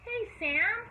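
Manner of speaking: high call to Sam. A narrator's reading. Mama Sue calling Sam on the porch, high call. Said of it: "called Mama Sue".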